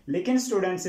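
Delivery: normal talking voice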